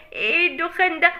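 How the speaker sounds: normal talking voice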